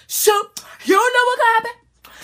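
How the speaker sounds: worked-up exclamation; emphatic. Real, not acted. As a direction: stress the danger. Emphatic about danger.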